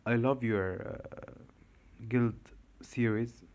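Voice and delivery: ordinary talk, nothing marked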